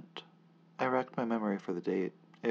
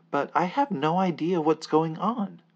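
loud bewilderment; 9 semitones higher, 12 dB louder than usual. Acted.

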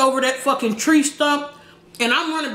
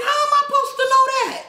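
high, fast exasperated yell; mock indignant; 14 semitones higher, faster than usual. Answering her laughing brother, high exasperated yell. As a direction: throw it back high and fast. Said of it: "I'm like".

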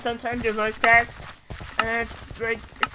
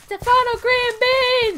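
high cheering shout; 9 semitones higher, 5 dB louder than usual. Pretend. Gamer cheering on his own character, high shout. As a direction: yell the name like a stadium announcer. Corrects name, cheering loudly.

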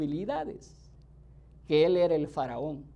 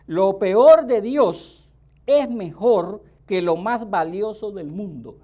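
emphatic slow preaching; 8 dB louder, slower than usual. Real, not acted. Preacher stressing key claim.

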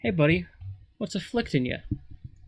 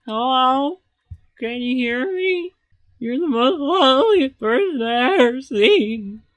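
loud, high lovestruck calling to a mermaid; a sailor hollering compliments across the water; smitten sailor calling out; 8 semitones higher, 9 dB louder than usual. Retold or imitated. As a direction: yell up at her like a besotted doof. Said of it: "That's how I imagine him talking".

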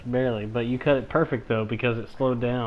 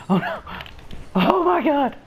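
rising into a loud high shriek; panicking gamer; 8 dB louder than usual. Real, not acted. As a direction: panic as the ball rolls in. Goal about to go in.

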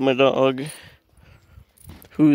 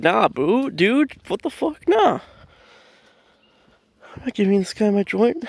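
higher-pitched, protesting retort; incredulous refusal; 6 semitones higher than usual. Retold or imitated. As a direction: refuse like it's absurd. Acted refusal to his friend.

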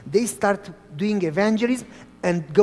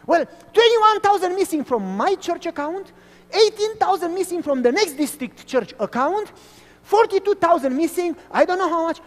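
higher, building outrage over the sums; rising outrage; 10 semitones higher, 4 dB louder than usual. Retold or imitated. Pastor listing missing church money, higher excited pitch. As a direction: pile up the numbers in disbelief.

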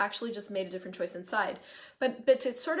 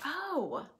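light realization; 6 semitones higher than usual. Retold or imitated. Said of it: "I'm like".

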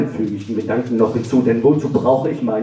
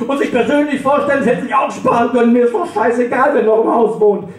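spiteful yelled last word; parting shout; 10 semitones higher than usual. Acted. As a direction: fling it up the stairs in a rage. Yelling after reopening the door.

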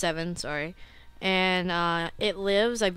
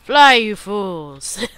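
hammed-up loud cry, drawn out; mock dramatic shout; 2 semitones higher, 12 dB louder, much slower than usual. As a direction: call it out like a grand warning. Calling out a quote at game.

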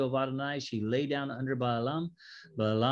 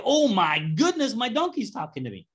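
higher, louder, startled gasp of disbelief; mock freak-out; 9 semitones higher, 5 dB louder than usual. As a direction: play a man stunned his donkey speaks. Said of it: "freak out and say".

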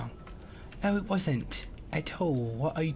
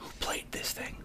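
hushed question; whispering; 11 dB quieter than usual. Real, not acted. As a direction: whisper it under the briefing. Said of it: "unvoiced whisper".

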